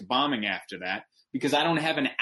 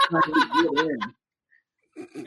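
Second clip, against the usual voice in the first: laughing; 5 semitones higher, 5 dB louder, much slower than usual. Real, not acted. Laughing at door rattling memory.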